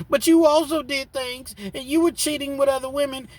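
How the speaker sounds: high, loud, heated back-and-forth; heated argument. Retold or imitated. Husband and wife fighting in the car, high and loud. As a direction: yell the accusations and excuses.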